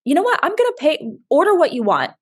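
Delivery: high, bright-eyed kindness to a stranger; sweet generous offer. Retold or imitated. To the man at the drive-thru, a high sweet offer. Offering to pay for his order.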